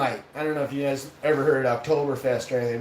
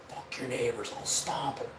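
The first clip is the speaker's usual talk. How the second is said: low, soft, half-whispered defiance; hushed retort; 4 semitones lower, 10 dB quieter than usual. Retold or imitated. Answering the buddy who shushed him, hushed defiance. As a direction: answer back under your breath.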